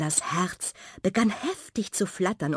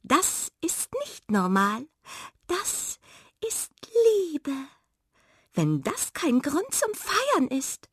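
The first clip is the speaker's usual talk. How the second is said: high, halting, awed mouse; Waldmaus, moved; 11 semitones higher than usual. Acted. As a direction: pause between words in wonder. Mouse marvels at love. (hohe Stimme, ergriffen stockend)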